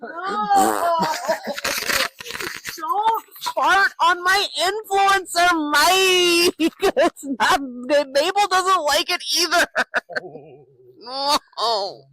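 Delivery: high, slow, laughing through words; laughing protest. Real, not acted. Brother farts on mic.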